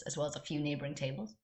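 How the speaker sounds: ordinary delivery, nothing marked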